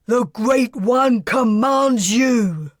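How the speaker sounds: slow, loud bellowed command; drill sergeant voice. Acted. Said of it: "in his best drill sergeant voice, he bellowed".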